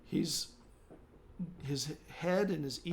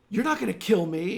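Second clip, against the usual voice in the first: indignant villager; 5 semitones higher, 9 dB louder than usual. Acted. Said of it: "he says to somebody near you".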